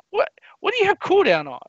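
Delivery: can't believe what he's hearing; incredulous. Retold or imitated. Player grilling his friend about his gear, incredulous.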